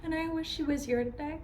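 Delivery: acted grief, close to crying; holding back tears. Grieving woman missing her best friend, holding back tears. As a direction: wish aloud, then apologize while fighting tears. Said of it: "I promised you I'm not gonna cry".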